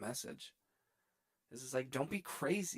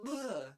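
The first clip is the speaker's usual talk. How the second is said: high "ugh" of revulsion; disgusted groan; 9 semitones higher than usual. Real, not acted. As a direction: groan as if grossed out. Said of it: "makes me feel gross".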